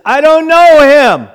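loud, high, emphatic shout; shouted denial. Retold or imitated. Peter denying Jesus a third time, shouted denial. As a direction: yell the denial at full force.

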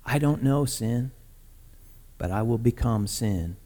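low, hushed, measured explanation; gentle Jesus. Retold or imitated. Jesus telling John why he's here, soft and low. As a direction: explain quietly, pausing between phrases.

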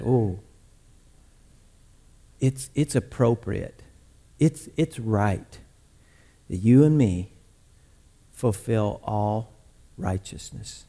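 very soft, low, halting reply; gentle Jesus. Retold or imitated. Jesus quietly persuading a resisting John, hushed and low.

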